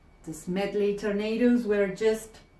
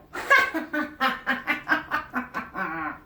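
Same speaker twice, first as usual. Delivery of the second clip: wild laughter; 4 semitones higher than usual. Acted. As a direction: coach cracking up in long wild laughter. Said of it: "she heard wild laughter".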